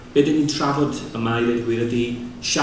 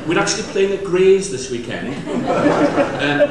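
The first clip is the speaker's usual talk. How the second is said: laughing; 7 semitones higher, 6 dB louder, slower than usual. Real, not acted. Joke about the football ground.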